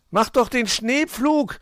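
hoher, lauter, gedehnter Zuruf; aufgeregtes Rufen. A narrator's reading. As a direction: ruf es aufgeregt hinterher, langsam und hoch. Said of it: "rief ihm Hoppel aufgeregt hinterher". (aufgeregt hinterherrufen)

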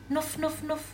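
quick high nasal nuff sniffs of a hedgehog. Acted. Mother hedgehog sniffing in alarm at the empty fridge. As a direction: sniff like a hedgehog, three short puffs. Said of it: "Rief Frau Igel".

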